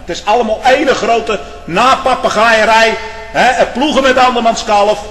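loud, high fire-and-brimstone preaching; preaching at full force. Acted. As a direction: thunder it from the pulpit. Preacher attacks self-righteousness.

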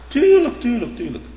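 sarcastic tuurlijk jumping high then dropping; mock agreement; mocking sarcasm. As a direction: scoff at them with a high sneer.

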